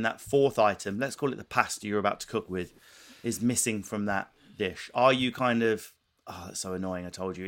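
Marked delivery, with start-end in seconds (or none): 6.3-7.5 softer, level, controlled irritation; quiet, restrained complaint; calm voice. Retold or imitated. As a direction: keep the annoyance under a calm lid. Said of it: "in a calm voice".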